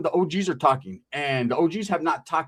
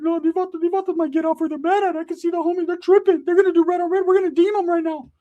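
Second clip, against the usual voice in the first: cousin Ricky voice; 14 semitones higher than usual. Retold or imitated. Cousin Ricky pulling him aside, in a high squeaky voice.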